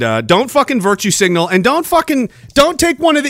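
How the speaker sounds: ordinary talk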